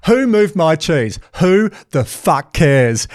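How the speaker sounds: hammed-up retelling of a blunt punchline, slow and high; mock presenter. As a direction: imitate the speaker drawing it out slowly to the punchline. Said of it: "and you just went".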